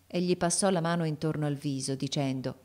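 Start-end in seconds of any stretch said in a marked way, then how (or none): none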